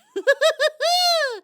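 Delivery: very high, loud delighted cackle; shrieking squirrel cackle. Acted. Squirrel laughing at her own cleverness, a delighted shriek.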